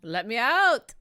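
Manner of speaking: loud demanding yell; hammed-up plea to escape; mock shout. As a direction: shout to be let out. Yelling to escape the game map.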